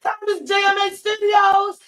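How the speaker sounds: high, loud, drawn-out host greeting; hyped show intro. Real, not acted. Host welcomes everyone into the building, hyped. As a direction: belt out the show opener.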